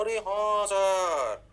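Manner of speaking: hugely drawn-out, falling yes-sir; mock student agreement. Teacher answering himself as the class, exaggerated agreement. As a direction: stretch every word as an exaggerated pupil.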